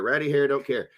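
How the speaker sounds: usual speaking voice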